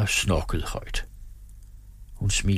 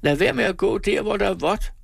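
very high maid's shout; pitched-way-up girlish scolding; 12 semitones higher than usual. A narrator's reading. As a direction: call out in a high girl's voice. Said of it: "råbte hun med sin høje pigestemme".

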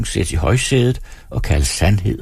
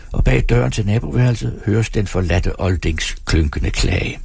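ordinary delivery

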